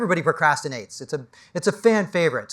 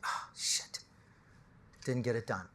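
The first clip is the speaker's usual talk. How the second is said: breathy whisper of dismay; whispered regret; 4 semitones lower, 14 dB quieter than usual. Pretend. Dying person whispers regret over unwritten book.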